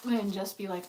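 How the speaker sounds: normal talking voice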